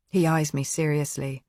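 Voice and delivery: usual speaking voice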